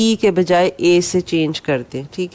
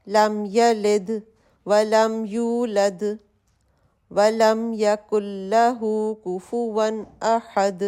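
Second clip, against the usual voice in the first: slow, soft recitation of Surah Ikhlas; Quran recitation; 3 dB quieter, much slower than usual. Shown as a demonstration. Teacher softly demonstrates Surah Ikhlas in prayer. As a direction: recite softly with long vowels. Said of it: "سورہ اخلاص mostly پڑھی جاتی ہے".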